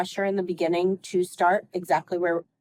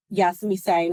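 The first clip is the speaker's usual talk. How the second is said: ordinary delivery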